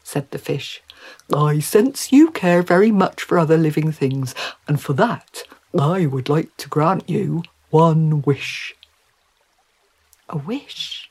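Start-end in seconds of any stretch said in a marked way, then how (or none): 1.3-8.7 put-on fish character voice; courteous creature granting a wish; gracious talking trout. 10.3-11.1 softly awed whisper, rising at the end; much softer, hushed query; whispered question. Acted. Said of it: "whispered Mary".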